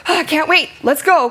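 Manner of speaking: high-pitched gasped eagerness; eager, urgent. Shown as a demonstration. Character answering partner's urgent call to leave, eager. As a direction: jump at the chance to go. Acts matching reply to urgent line.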